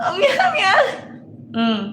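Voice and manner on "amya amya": much higher squealing mock Japanese. Showing how Japanese sound, high squealing mock Japanese. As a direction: squeak it out, laughing, as a parody. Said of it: "Ganun pag Japanese".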